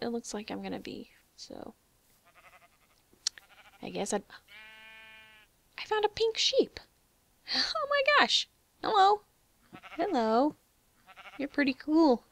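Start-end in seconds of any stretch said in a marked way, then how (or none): 5.8-12.2 high, loud delighted squeal; excited squeal. Real, not acted. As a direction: greet it like a cute pet. Excited greeting to pink sheep.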